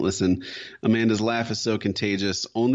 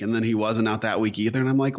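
ordinary delivery

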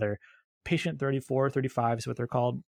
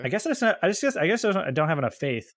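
mock sheepish; 6 semitones higher, 4 dB louder, faster than usual. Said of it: "mock self-deprecating punchline".

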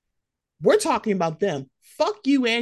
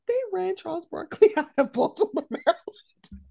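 laughing; 10 semitones higher than usual. Real, not acted. Laughing retelling Barkley story.

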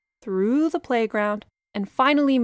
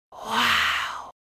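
breathed. Acted. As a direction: breathe it out in awe. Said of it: "she breathed".

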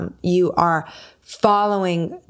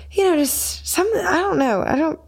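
nervous hedging; 10 semitones higher than usual. Retold or imitated. Hedging to sound guy.

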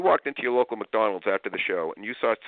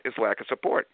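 ordinary talk, nothing marked

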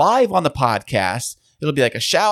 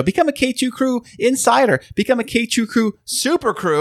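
infomercial pitch; 8 semitones higher than usual. Acted. Sales pitch call to action.

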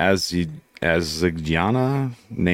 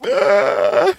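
high mock child's scream; a short high retold scream; 13 semitones higher, 3 dB louder than usual. Child actor screaming at the fake death, high mock scream. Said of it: "And then she goes".

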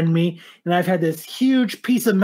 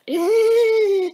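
mocking squeak. Imitating weak car.

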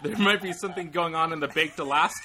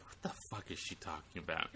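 low, very soft retold aside; hushed, baffled inner thought; bewildered undertone; 8 semitones lower, 16 dB quieter than usual. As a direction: drop right down as if thinking it privately. Bewildered aside in retold phone call.

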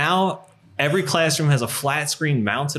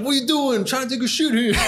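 high, put-on voice, acting caught on the toilet; mock indignant mascot; 13 semitones higher, faster than usual. In the mascot costume, faking being caught: mock indignant. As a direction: pretend to be outraged someone barged into the stall. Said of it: "And I'm like".

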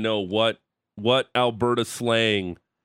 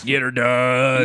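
Larry the Cable Guy; 3 semitones higher, 6 dB louder, much slower than usual. Retold or imitated. Host imitates Larry the Cable Guy, slow and loud. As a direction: ham up the comedian's catchphrase. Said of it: "more like a Larry the Cable Guy thing".

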